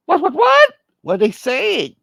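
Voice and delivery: very high, loud, stuttered panic; hammed-up high-pitched fretting; alarmed phone reply. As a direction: squawk it in mock alarm. Acted alarmed reply on phone call.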